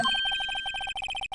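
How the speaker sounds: big hammed-up throat clearing; overdone throat clear. Pompous old lawyer theatrically clearing his throat. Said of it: "the most overtop clearing of the throat".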